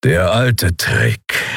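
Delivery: slow, grudging acknowledgement; grudging growl. Acted. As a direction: admire it against your will, slowly. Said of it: "knurrte Kero mit widerwilliger Anerkennung".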